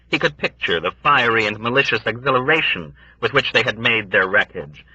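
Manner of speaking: ordinary delivery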